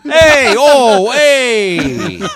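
very loud, high catchphrase shouts; booming high celebrity impression; Tony Danza. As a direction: holler it like a brash sitcom star. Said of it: "Tony Danza is Dracula".

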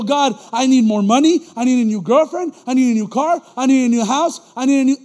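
loud, insistent list of demands; mock selfish demands. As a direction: demand each item loudly, hammed up. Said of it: "It's always about them".

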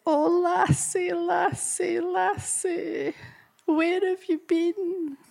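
high, slow, moved old Scottish lady, lightly voiced; tearful old lady. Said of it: "she starts crying".

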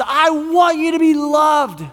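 high, emphatic proclamation; voice of God. Acted. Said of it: "And God says".

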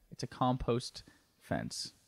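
soft, slow, matter-of-fact; quiet deadpan correction. Retold or imitated. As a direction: calmly insist it's compost. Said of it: "he said".

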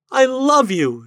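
high, loud declaration of love; anguished cry. A narrator's reading. Lady crying her love to the ghost, high and anguished. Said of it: "she cried".

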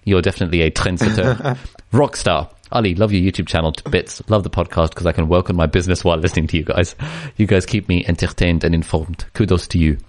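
reading aloud in a mock French accent; put-on French accent, gently comic; fake French accent. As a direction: take the piss out of a French accent. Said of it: "an impressive French accent".

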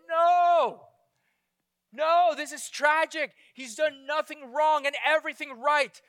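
loud, high anguished protest; protesting cry. Retold or imitated. Preacher voices the crowd's outraged protest at the cross, loud. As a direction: cry out against the injustice. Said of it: "we say".

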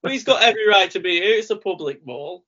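high, loud, rushed disbelief; mock commentator outburst; imitating a frantic commentator. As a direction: yell it like a stunned announcer calling a surprise arrival. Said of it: "we have, with".

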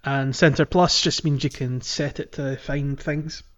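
normal talking voice

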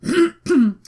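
quick apology, then a chesty cough. Real, not acted. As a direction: cough to clear the throat. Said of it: "ik moet even hoesten".

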